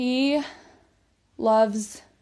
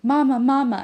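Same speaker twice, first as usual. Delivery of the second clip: put-on mild screaming child; 3 semitones higher, 9 dB louder than usual. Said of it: "her kid got buried and was screaming".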